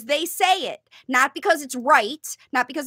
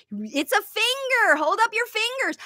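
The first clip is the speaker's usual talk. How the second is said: put-on high, sing-song child talk; a mild sing-song parent showing a finger; modeling for child; 8 semitones higher than usual. Shown as a demonstration. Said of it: "encourage the child to try it".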